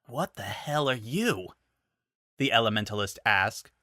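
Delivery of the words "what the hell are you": shaken. Acted. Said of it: "her fingers quivering".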